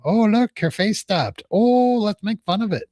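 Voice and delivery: high, exaggerated mock delight; mocking imitation. Host mimics someone making fun of a frozen face, mocking.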